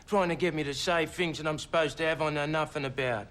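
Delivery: ordinary delivery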